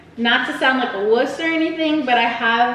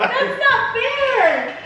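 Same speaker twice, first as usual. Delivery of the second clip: laughing whine; 11 semitones higher, much slower than usual. Real, not acted. Laughing protest.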